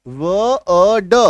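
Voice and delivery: "व अ ड" drawn out sound by sound; extremely slow segment-by-segment demo. Shown as a demonstration. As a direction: stretch "व", "अ", "ड" very slowly. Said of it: "उच्चारण क्या होगा".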